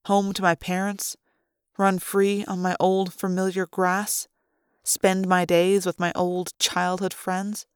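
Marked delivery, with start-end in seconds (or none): none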